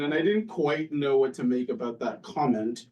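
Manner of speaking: ordinary talk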